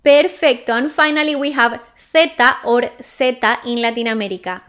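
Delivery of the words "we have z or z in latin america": normal talking voice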